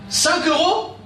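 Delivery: high, incredulous question; shocked at the price. Acted. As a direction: balk at the price, pitch jumping up. Père apprenant le prix de la crêpe. (stupéfait par le prix)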